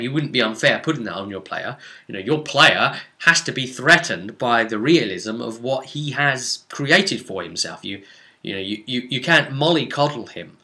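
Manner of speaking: ordinary delivery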